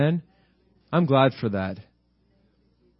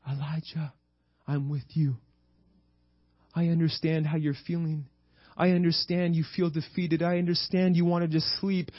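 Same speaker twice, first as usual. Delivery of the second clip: soft, lowered, tender voice; loving reassurance; 5 semitones higher, 4 dB quieter than usual. Acted. God to defeated Elijah, lovingly reassuring. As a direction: comfort him gently, leaving pauses. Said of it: "He lovingly talks to him".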